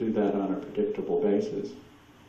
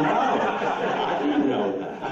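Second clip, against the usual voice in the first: laughing innocence; 6 semitones higher, 3 dB louder, much slower than usual. Retold or imitated. Retelling his innocent reply, laughing.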